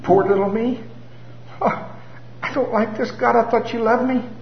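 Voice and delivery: high, whimpering self-pity; mock sorry-for-myself moaning; pitiful whine. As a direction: play the poor wounded victim. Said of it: "that voice that begins to".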